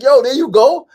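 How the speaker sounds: high loud excited call. Retold or imitated. Friend Sefu spots the photo appearing: high loud excited call. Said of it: "he like".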